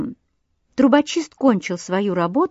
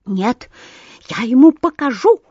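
higher, louder, breathless with rage; furious yell; 4 semitones higher, 6 dB louder than usual. A narrator's reading. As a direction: spit it out in a fury. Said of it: "орал Воробей Воробеич, задыхаясь от ярости".

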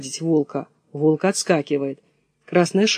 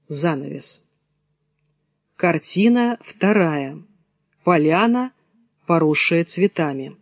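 normal talking voice

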